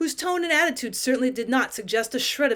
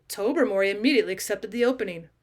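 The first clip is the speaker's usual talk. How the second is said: plain reading voice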